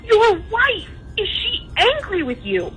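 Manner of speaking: normal talking voice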